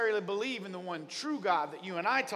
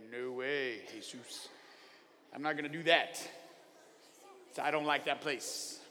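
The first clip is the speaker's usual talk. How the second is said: low, quieter grumbling Jonah voice; sulky Jonah; 10 semitones lower, 11 dB quieter than usual. Acted. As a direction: Jonah shrugging off blame, mumbled low. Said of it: "He's like".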